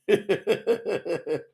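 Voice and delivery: high run of chuckles; laugh. Real, not acted. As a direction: chuckle at yourself in quick pulses. Laughs at own near crying.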